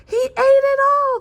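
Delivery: high, loud, delighted cheer; happy cheer. A narrator's reading. Fox cheering that the Moon Man ate the feast, gleeful high cheer. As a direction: cheer it out with glee. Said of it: "cheered Fox happily".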